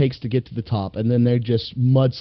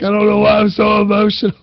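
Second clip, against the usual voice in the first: higher, louder mock of a friend's whine; emotional friend; 9 semitones higher, 5 dB louder than usual. Retelling his friend's confession, mock whiny voice. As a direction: lightly play the weepy Italian friend. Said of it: "He's like".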